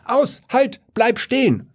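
very high shouted stop; shouting. A narrator's reading. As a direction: yell it at the driver up high. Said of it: "schrie ich". (sehr hoher Schrei)

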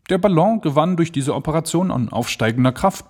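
usual speaking voice

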